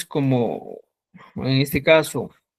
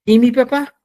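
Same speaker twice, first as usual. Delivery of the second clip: ¿Y mi papá? higher and louder, mi stressed; emphatic question; 7 semitones higher, 7 dB louder than usual. Shown as a demonstration. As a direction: call out ¿Y mi papá? as the model. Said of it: "Vamos a utilizar el famoso "y". (pregunta enfática: ¿Y mi papá?)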